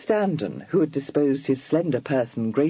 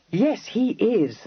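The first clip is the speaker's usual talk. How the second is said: enthusiastic; 9 semitones higher, slower than usual. A narrator's reading. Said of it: "with enthusiasm".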